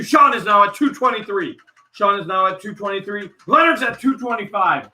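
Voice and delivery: high, loud live bid calling; excited auction announcer, projecting over the room; auctioneer call. Real, not acted. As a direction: rattle off the bids with rising excitement.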